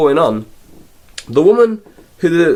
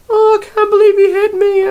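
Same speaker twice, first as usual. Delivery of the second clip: high, loud hammed-up wailing cry; mock sobbing; 4 dB louder than usual. The slapped woman crying to Rip, hammed-up wail. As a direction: wail it like a bad soap actress. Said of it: "she's in Rip's room".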